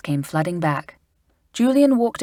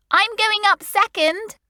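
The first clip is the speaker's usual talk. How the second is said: put-on much higher girl's voice; Anne crying out; 4 dB louder than usual. A narrator's reading. Anne claiming her turn, high and eager. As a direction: call out second place. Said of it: "cried Anne".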